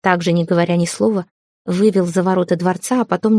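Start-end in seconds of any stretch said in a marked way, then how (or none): none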